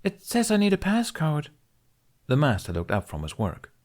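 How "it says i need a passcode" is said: put-on high, fast little girl voice; young girl's voice. A narrator's reading. Youngling asks the visiting master politely, high childlike voice. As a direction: voice her as a small eager child. Aola tells her master.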